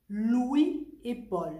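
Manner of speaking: Louis et Paul very slow with no link between Louis and et; no liaison. Shown as a demonstration. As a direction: keep "Louis" and "et" apart, no joining sound. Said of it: "Je ne fais pas non plus la liaison".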